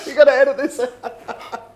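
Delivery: loud laughing plea, high and slow; laughing hard. Real, not acted. To the guest, laughing loudly about the cut. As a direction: laugh it out while begging.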